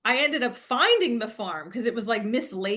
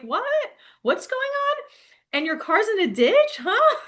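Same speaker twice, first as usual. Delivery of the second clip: put-on much higher, bemused old-timer voice; mock country neighbor, higher, ending in a laugh; elderly couple; 9 semitones higher than usual. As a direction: act baffled by the stranger. Elderly couple continue quoting.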